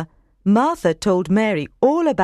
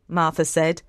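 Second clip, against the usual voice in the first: ordinary delivery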